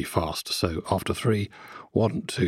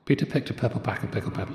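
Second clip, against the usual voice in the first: fast tongue twister; 4 semitones higher, faster than usual. Shown as a demonstration. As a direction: rattle it off quickly. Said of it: "tongue twister said twice, faster".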